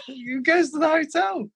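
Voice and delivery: high, drawn-out words through real laughter; laughing while talking. Real, not acted. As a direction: crack up as you tell it. Cracks up retelling the story.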